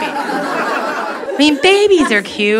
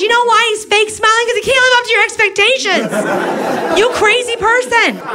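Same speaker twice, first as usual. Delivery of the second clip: incredulous rant; 9 semitones higher than usual. Acted. Comic to a fussy mom, a high incredulous rant. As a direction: let it climb in disbelief at the mom.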